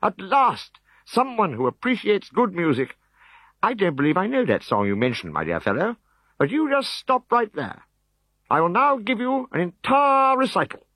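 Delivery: high, eager bard voice; a vain singer, thrilled. Acted. Cacophonix to the Decurion, high and eager.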